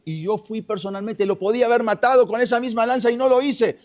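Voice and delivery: high voice building to loud; accusing declaration. Retold or imitated. David confronts Abner with the stolen lance, forceful accusation. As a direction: drive the proof home, rising in force.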